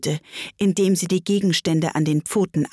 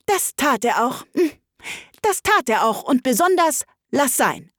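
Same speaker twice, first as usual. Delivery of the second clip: higher, lightly voiced sighing creature; understated wistful sigh, pitched up; sighing Gryphon; 8 semitones higher than usual. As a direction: sigh it out in a slightly raised character voice. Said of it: "indem er ebenfalls seufzte".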